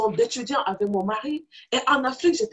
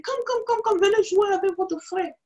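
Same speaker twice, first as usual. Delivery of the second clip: put-on calling the children; 8 semitones higher than usual. Mother calls her daughters over.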